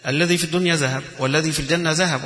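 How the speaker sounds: ordinary talk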